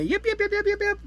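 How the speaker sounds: high yipping imitation of coyotes; coyote yips. Retelling the coyotes at night, high yipping. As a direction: yip like a pack of coyotes. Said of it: "bunch of coyotes start up".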